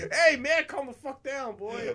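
put-on high, loud yell at the kid to calm down; mock yell. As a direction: shout him down, half laughing.

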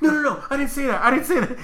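high, loud mock panic; panicked denial. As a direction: imitate frantic backpedalling with a laugh behind it. Retold driver panicking in denial.

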